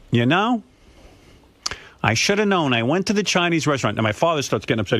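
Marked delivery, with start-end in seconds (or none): 0.1-4.0 higher-pitched mother voice, a touch rueful; lightly voiced mother admitting it; imitating mother. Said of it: "my mother says to me".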